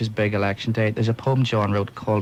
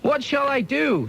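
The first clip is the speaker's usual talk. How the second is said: put-on much higher, slow childish plea; wee George's voice; slower than usual. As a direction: whine it like a lost little boy.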